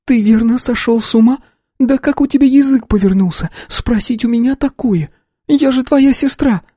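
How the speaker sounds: a shocked sister, outraged at the question; indignant sister. A narrator's reading. Sister Katya's lines voiced.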